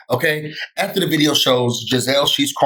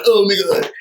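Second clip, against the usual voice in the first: higher-pitched mocking gush; mock put-on persona; 7 semitones higher, 3 dB louder than usual. Mimicking her in front of Andy Cohen, mock put-on. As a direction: imitate her fake on-camera act. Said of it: "she's acting differently when the cameras turn on".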